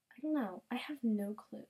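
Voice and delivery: soft whispered shrug; whispering, lost for ideas; whispered aside. Real, not acted. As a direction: mutter it to yourself in a whisper. Unsure what to search.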